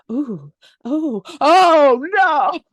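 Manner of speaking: moans building to a high, loud cry; mock waking moans. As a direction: act out waking up, swelling to a big "oh no". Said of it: "she wakes up and she's like".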